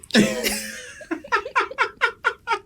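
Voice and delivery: long high-pitched laugh; real laughter. Real, not acted. Host cracks up at the raven joke, real laughter. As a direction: burst out in a high, sustained laugh.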